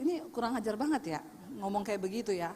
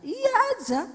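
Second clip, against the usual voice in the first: emphatic advice; 12 semitones higher, 6 dB louder than usual. Real, not acted. Urging just to agree.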